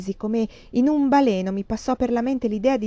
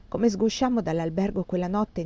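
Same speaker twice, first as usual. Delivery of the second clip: plain reading voice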